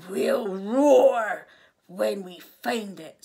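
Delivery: loud, slow, drawn-out lion voice; roaring lion. A narrator's reading. Lion promises Elmer a signal, loud and roaring. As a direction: boom it out like a lion. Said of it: "said the lion".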